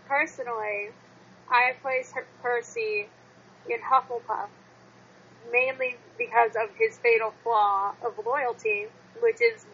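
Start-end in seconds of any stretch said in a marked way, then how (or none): none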